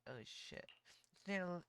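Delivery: usual speaking voice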